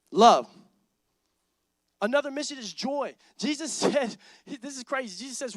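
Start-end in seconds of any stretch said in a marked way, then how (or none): 0.1-0.5 love called out low and loud; loud preached word. Real, not acted. Pastor landing the point for the crowd, loud. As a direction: drop low and punch it.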